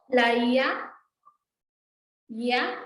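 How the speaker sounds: "ia" with i gliding into a; "ia" said as one gliding syllable; ia glide demo. Shown as a demonstration. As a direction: blend "ia" smoothly. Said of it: "la unión de la i con la a".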